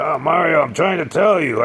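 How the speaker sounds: high, loud whiny doctor; imitating the flustered doctor, high and loud; mock doctor. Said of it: "He said".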